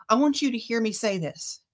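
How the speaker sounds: ordinary talk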